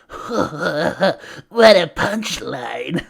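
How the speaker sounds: slow, drawn-out villain quip; Joker. Retold or imitated. Villain impaled by Superman delivers his last quip, mockingly hammed up. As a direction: put on a cackling villain, stretching each word. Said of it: "He's like".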